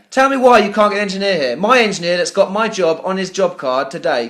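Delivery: ordinary talk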